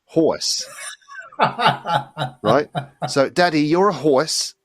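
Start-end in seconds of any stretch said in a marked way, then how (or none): 0.0-0.6 "horse" pitched up, with a New Jersey vowel. Shown as a demonstration. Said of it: "she doesn't call them horse". 3.3-4.5 put-on high, slow little-girl New Jersey accent; daughter's New Jersey accent. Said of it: "this New Jersey accent's coming from".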